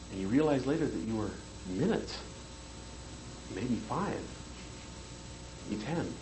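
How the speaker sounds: soft, drifting suggestive voice; softly planting doubt like a hypnotist; hypnotic suggestion. Shown as a demonstration. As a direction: lull them with quiet confusion. Hypnotic suggestion demo to audience.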